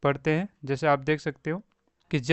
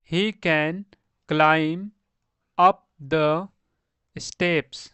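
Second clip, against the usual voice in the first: word by word with long pauses; slow model reading; much slower than usual. Shown as a demonstration. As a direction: read it out slowly for learners. (रुक-रुककर, धीरे-धीरे पढ़ना)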